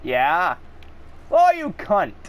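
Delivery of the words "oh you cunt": high, sharp furious jab; real gamer fury, pitched up; angry insult. Real, not acted. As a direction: snap at the enemy. Enemy attacks player.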